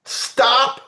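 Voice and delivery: high, insistent correction; scolding correction; exasperated retort. Retold or imitated. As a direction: tell her to stop, exasperated. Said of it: "And I just went".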